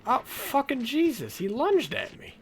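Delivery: startled. Real, not acted. Startled by a lunging zombie.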